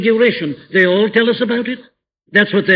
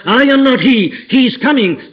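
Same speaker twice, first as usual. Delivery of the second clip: emphatic cry; 6 semitones higher, 4 dB louder than usual. Acted. Preacher voicing John's denial.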